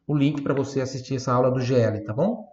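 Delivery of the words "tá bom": ordinary talk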